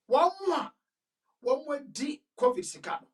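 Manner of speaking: mock-stern repeat of his words; lightly hammed-up emphatic leader; emphatic quote. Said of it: "he was very emphatic".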